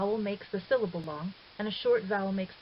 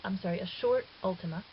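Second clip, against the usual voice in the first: usual speaking voice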